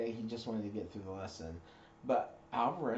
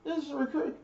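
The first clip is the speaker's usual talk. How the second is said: high, loud recruit reply; recruit shouting back; 4 dB louder, faster than usual. Retold or imitated. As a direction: sound off like a scared recruit. Recruit answering.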